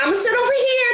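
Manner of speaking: very high casual announce; light playful self-quote; mock breezy reply. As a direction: toss it off breezily. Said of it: "I'm like".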